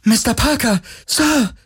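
high, bright-eyed call of delight; childlike joy. Acted. Kowalski greeting Parker with childlike joy. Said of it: "mit fast kindlicher Freude".

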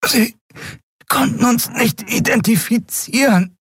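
high, strangled, broken speech; choked. A narrator's reading. Strangled woman explains herself to her attacker, choking. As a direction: squeeze the words out in halting pieces. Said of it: "brachte sie erstickt hervor".

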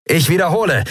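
high, urgent repeated summons; calling out. A narrator's reading. Crewman repeating his summons to the captain, urgent call. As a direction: call insistently down a speaking tube. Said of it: "rief Sephiron in eines der Sprechrohre".

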